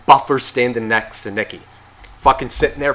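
ordinary delivery

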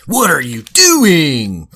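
mock a cheesy game voice actor; drawn-out corny video game delivery. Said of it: "the cheesiest voice actor with the worst recording equipment".

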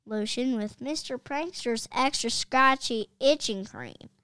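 loud complaint that drops off at the end; furious coach yelling at the field; coach's shout. Acted. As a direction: yell it in outrage. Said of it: "shouted the coach".

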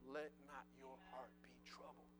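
hushed whisper over soft music; whispered scripture. Pretend. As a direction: breathe the verse to yourself. Scripture quoted as self-talk.

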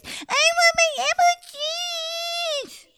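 put-on very high, slow whining toddler; baby refusing to use a big girl voice; drawn-out baby whine. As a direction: stretch the whine out like a stubborn baby. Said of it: "if you use a big girl voice".

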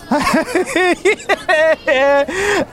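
high, strained cackle; long unhinged high laugh; exasperated laugh. Acted. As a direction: laugh as if cracking up from frustration. Customer cracking at another breakage.

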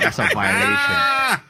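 high, loud, drawn-out protest; mock outrage. Friend retelling his reaction to a college film rumour, mock outrage. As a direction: cry it out slowly in exaggerated outrage.

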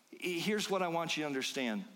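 ordinary talk

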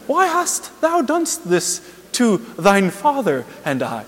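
high, slow, stilted scripture voice; exaggeratedly lofty parody of holy speech; mock-pious Mary. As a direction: ham up archaic, saintly solemnity. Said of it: "please don't think that she was like".

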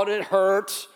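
normal talking voice

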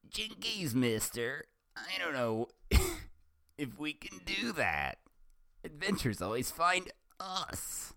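put-on very high, excited girlish voice; Velma voice. Velma shouting to the doctor, very high. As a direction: squeak it out high and giddy. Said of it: "the girl shouted".

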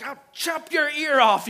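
hammed-up swordsman lunging; mock threat. Peter, retold, threatens the priest's servant, a hammed-up loud threat.

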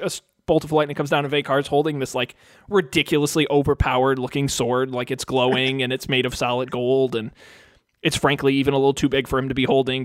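ordinary talk, nothing marked

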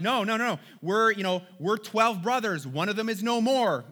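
protesting. Acted. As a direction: insist in a hurry to be believed. Brothers' acted protest of innocence.

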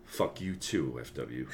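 soft muttered insult; low aside with a laugh; quiet. Acted.

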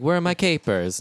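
high, loud Italian dad voice. Retold or imitated. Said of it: "He comes in, he's like".

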